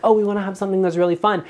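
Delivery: high, quick mock-eager quote; lightly put-on high, fast voice; imitated eager homeowner.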